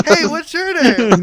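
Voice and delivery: high chirpy voice through laughter; laughing. Retold or imitated. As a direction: squeak the greeting while cracking up. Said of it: "this four-foot-eleven person is like".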